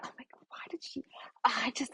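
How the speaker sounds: fast, hushed whisper of disbelief; stunned whisper. Real, not acted. Vlogger recapping the show, fast stunned whisper. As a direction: rush it under your breath, aghast. Shocked reaction to reality show scene.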